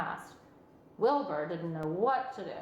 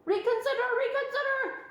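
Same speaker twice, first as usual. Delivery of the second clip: high, loud goose plea; crying goose; 4 dB louder than usual. A narrator's reading. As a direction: cry it out like a goose. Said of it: "cried the goose".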